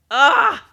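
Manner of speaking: loud, high burst of "ah"; delighted cry. Real, not acted. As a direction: let out a big relishing "ah". Delight at making friends laugh.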